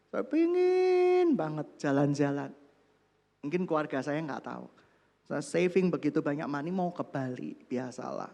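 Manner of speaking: ordinary talk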